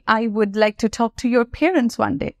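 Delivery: very slow, careful model sentence; slow teaching model. Shown as a demonstration. Teacher modelling a formal sentence for students. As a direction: read it out slowly and clearly. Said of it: "সেক্ষেত্রে আমি কিন্তু বলতে পারি".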